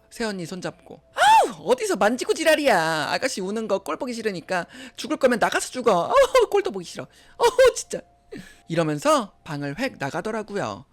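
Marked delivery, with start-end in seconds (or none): 1.1-8.0 high, loud exasperated snapping; disgusted outburst. Acted. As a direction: recoil with shrieky groans of disgust. Sister-in-law recoils and storms out. (질색하며 짜증내는 고함)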